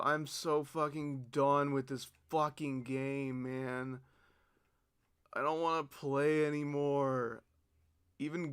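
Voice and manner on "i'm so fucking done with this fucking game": ordinary talk, nothing marked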